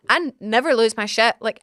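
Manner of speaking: ordinary talk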